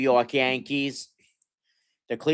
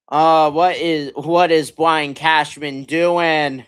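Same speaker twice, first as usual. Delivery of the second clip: higher, louder, slow, exasperated question; outraged demand; 6 semitones higher, 8 dB louder, slower than usual. Real, not acted. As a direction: demand an answer from the GM. Fan outraged at the GM.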